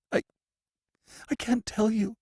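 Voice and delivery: terrified prisoner refusing the warden; stammering fear. A narrator's reading. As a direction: stumble over the words in dread. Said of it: "stammered the prisoner".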